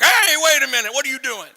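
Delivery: acted high, loud protest; alarmed shout. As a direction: burst out to stop someone mid-action. Peter objects to Jesus washing feet.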